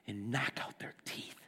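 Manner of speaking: and knock out their teeth, breathed in a low whisper; whispered prayer. Acted. David crying out to God in despair, whispered. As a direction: whisper the plea, spent and low. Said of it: "He's like".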